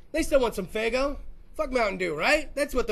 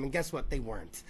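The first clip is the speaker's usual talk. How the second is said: usual speaking voice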